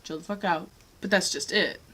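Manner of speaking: ordinary talk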